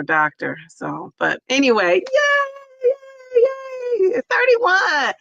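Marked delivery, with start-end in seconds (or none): 1.9-5.1 shrill celebratory squeal; birthday cheer. Real, not acted. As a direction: cheer her birthday like a party.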